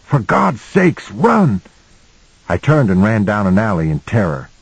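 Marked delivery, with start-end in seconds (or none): 0.1-1.6 desperate high shout; yelling. A narrator's reading. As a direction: plead with a panicked yell. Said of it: "he yelled".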